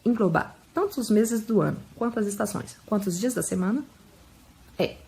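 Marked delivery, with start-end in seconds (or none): none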